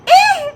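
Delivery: high, loud startled "Eh!"; shocked yelp. Real, not acted. Student startled by examiner's goodbye, high loud yelp. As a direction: yelp in alarm.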